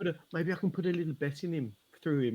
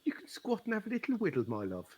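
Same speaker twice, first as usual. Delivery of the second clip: put-on West Country accent; 7 semitones higher, faster than usual. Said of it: "Very upset with your change in accent".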